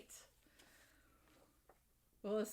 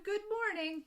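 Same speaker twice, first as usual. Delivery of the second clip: cheerful greeting; 11 semitones higher, 4 dB louder than usual. Acted. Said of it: "instead of".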